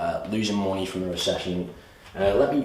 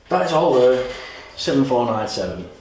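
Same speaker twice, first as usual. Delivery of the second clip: louder and higher, punching the total; presenter hammering home the full price, louder; emphatic announcement; 5 semitones higher, 7 dB louder than usual. Real, not acted. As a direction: sell the big number.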